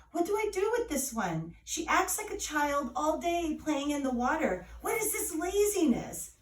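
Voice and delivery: mother complaining to the godmother in despair; despairing complaint. A narrator's reading. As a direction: throw up your hands in despair. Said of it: "In despair, Nana complained".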